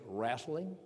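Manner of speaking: wrestling said slowly in a Southern country way; wrestling drawn out the way it's said down South. Shown as a demonstration. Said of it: "when I say the word wrestling".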